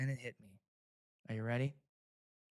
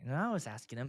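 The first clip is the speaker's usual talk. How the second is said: usual speaking voice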